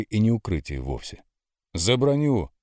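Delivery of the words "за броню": cover me shout. A narrator's reading. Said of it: "крикнул я".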